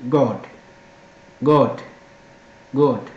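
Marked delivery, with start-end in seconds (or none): none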